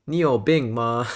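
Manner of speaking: "Ni you bing ma?" in Mandarin tones; Chinese phrase. Shown as a demonstration. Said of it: "what some people will say in Chinese is".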